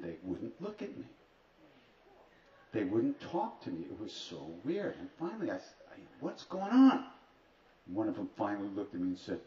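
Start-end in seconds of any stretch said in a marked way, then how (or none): 6.2-7.1 high, loud retold outburst; bewildered, raised-voice plea for an answer; exasperated demand. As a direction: blurt it out, voice rising sharply at the end. Confronting friends who won't talk.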